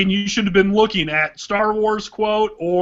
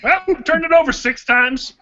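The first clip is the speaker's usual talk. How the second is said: much higher whiny coach voice; coach impression; 5 semitones higher than usual. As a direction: play the clueless coach. Coach impression starting with well.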